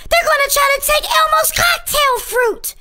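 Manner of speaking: usual speaking voice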